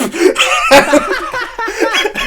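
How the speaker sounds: a burst of high laughter; loud high laugh. Real, not acted. Big laugh at punchline.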